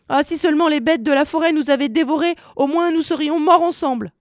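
high, hurried plaintive wailing; tearful lament. A narrator's reading. Gretel weeping as she carries water, high plaintive lament. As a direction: lament in a high, rushing stream. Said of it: "comme elle pleurait en charriant ses seaux".

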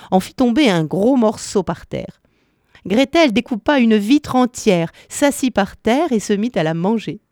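usual speaking voice

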